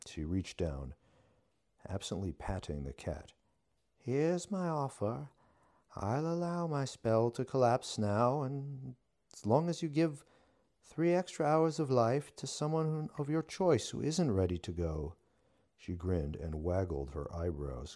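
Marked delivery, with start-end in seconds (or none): 4.0-15.2 high, loud mock-villain witch. A narrator's reading. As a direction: play it pitched high and loud. Witch voice held.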